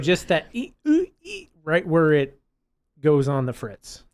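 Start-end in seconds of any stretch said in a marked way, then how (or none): 0.5-1.5 high-pitched mock robot glitch; glitching malfunction noises. Co-host mimicking her friend's brain going on the fritz, glitching squeals.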